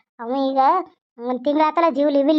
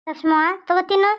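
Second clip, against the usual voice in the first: tearful, voice catching in sobs; crying; 6 semitones higher than usual. Acted. Grandmother weeps while offering food, tearful. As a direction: cry through the words. Emotional visit, crying heard.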